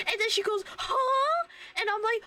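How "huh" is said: confused huh. Retold or imitated. Girl's confused reaction.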